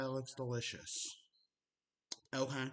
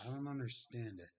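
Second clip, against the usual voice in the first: ordinary talk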